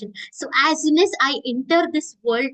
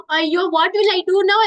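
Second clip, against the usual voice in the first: higher, louder cry of alarm; a lost child suddenly scared; panicked child; 5 semitones higher, 5 dB louder than usual. Retold or imitated. Child who lost her father crying out in panic.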